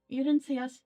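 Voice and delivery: normal talking voice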